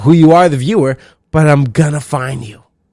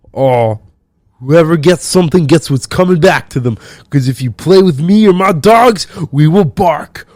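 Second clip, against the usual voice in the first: loud, higher put-on tough-guy meme voice; mock tough meme quote; 3 semitones higher, 4 dB louder than usual. Mocking the uncle's dog meme, loud and hammed-up. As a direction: ham it up like a macho caption. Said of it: "He definitely shares those pictures".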